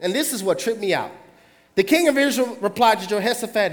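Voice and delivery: usual speaking voice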